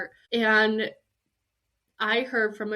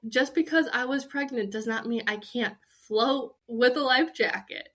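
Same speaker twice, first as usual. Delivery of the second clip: ordinary talk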